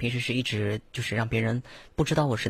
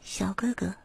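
soft whispered higher voice; whispered demo; 8 semitones higher, 6 dB quieter, slower than usual. Shown as a demonstration. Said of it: "再稍微往上抬一点".

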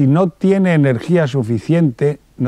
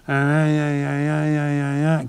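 soft whiny ay ay moans; mock complaining; 3 dB quieter, slower than usual. Shown as a demonstration. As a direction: moan ay ay softly, half in jest. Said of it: "Daos cuenta la diferencia".

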